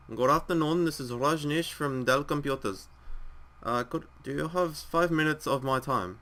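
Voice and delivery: telemarketer with a put-on Indian accent. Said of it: "That was the worst Indian accent".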